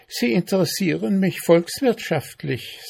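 high, officious ant voice. A narrator's reading. Ant questioning the mayfly, officious.

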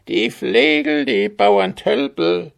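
very high, loud kobold jeer; squawking goblin shout. A narrator's reading. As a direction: shriek the insults like a goblin. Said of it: "schrie er".

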